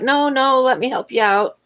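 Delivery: retold cashier refusing to let go of the cart; insistent cashier. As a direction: mimic him insisting on helping. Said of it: "And he's like".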